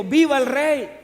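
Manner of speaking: cheering out loud for the king; a crowd's glad cry; joyful shout. Retold or imitated. Preacher voicing the crowd's glad shout for the king. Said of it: "gritó con alegría y dijo".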